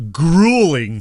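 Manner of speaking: "grueling" drawn out long and loud; stress on grueling. Shown as a demonstration. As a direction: lean hard and slowly on "grueling". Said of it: "Let me hit that word again".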